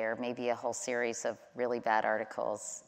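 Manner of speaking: normal talking voice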